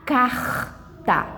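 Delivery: "carta" with Rio de Janeiro's raspy, throaty R; Rio accent. Shown as a demonstration. Teacher showing students how Rio says carta, Rio accent. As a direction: say "carta" the Rio way, R as a rough h-like rasp. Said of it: "eles vão falar assim".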